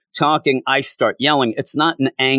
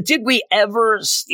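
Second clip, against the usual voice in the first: usual speaking voice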